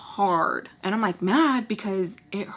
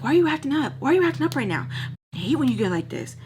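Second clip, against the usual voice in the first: pitched-up exasperated demand; imitating annoyed boyfriend; 6 semitones higher, much faster than usual. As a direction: act out his indignant complaint. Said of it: "he, like, starts getting mad".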